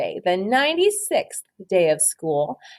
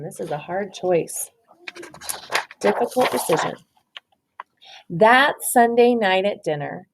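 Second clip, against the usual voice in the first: ordinary delivery, nothing marked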